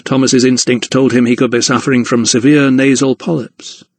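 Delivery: ordinary delivery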